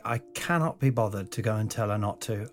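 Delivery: ordinary delivery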